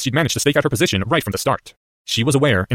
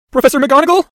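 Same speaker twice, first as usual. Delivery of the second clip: high, loud, wavering call; 7 dB louder than usual. Acted. Distressed boy calling to his professor, wavering. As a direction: call out high and unsteady for help. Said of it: "said in a wavering voice".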